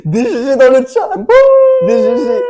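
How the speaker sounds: high, loud hype shout; excited. Real, not acted. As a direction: cheer it out loudly. Celebrating the balloon.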